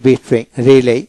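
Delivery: ordinary talk, nothing marked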